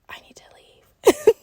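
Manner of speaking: high, slow mock-panicked whisper; panicked squeaky whisper. Podcaster imagining a friend crying at her, mock-panicked whisper. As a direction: whisper it thinly, as if desperate to escape.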